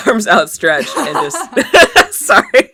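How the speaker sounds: loud, slow words breaking into high laughter; cracking up. Real, not acted. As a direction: try to narrate through helpless laughing. Cracks up retelling own move.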